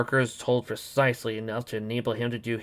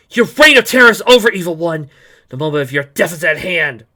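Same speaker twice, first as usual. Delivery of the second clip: high, loud heroic yell; Starfire; 5 semitones higher, 13 dB louder than usual. A narrator's reading. Attacker bursting in on the evil one, yelled challenge. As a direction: yell a battle challenge at the villain. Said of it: "Starfire yells out".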